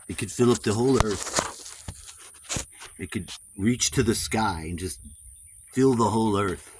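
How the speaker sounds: put-on solemn storybook narration, American accent; storyteller voice. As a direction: spin an ancient myth, American accent. Mock-solemn creation tale.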